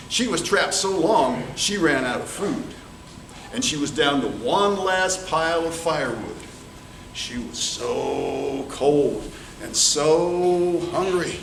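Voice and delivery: usual speaking voice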